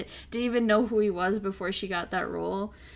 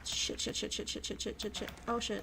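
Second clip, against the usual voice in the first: muttered panic; 12 dB quieter than usual. Real, not acted. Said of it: "muttering while fumbling clip".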